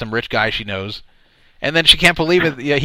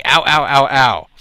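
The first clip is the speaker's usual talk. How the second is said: lightly hammed-up cries of pain, getting louder; mock pained yelling; 5 dB louder, slower than usual. Host repeating what the girl told him she heard, mock yelps. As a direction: imitate a run of pained yelps. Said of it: "That's what she told me".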